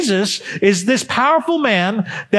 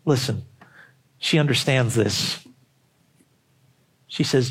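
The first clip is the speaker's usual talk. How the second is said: dropped low and much softer; hushed; 10 semitones lower, 6 dB quieter than usual. Acted. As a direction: pull them in close with a hush. Sudden drop after loud climax.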